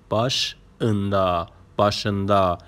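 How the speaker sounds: "başında" in two parts, baş... ında; split for learners. Shown as a demonstration. Teacher showing learners a new word, "başında" split after baş.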